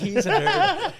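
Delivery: "nerd" cracked up high in laughter; laughing squeal. Real, not acted. Mocking his brother at the height of laughter. Repeats the jibe at laughing peak.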